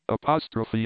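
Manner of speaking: ordinary delivery, nothing marked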